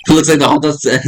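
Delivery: real accidental voice crack. Real, not acted. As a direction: voice cracks mid-line, rough and breaking. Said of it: "what was that voice crack".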